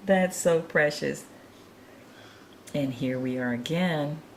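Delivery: ordinary talk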